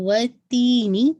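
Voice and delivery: وَالتِّینِ with تی stretched two counts, slow; tajweed demo. Shown as a demonstration. Teacher showing students how to stretch تی in وَالتِّینِ. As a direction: hold the تی of وَالتِّینِ for two counts and make the نی sharp. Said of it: "تی کو آپ نے دیکھیے ٹو count stretch کرنا ہے".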